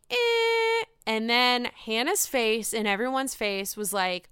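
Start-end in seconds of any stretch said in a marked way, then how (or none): 0.1-0.8 imitating a shrug. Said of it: "He does the whole half-see".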